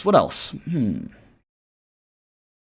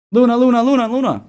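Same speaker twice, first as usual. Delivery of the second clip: higher and louder, chanting the coin name; excited call; 11 semitones higher, 8 dB louder than usual. Real, not acted. Streamer reacting to chat requests for Luna, excited chant. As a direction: cheer it out like a hyped chant.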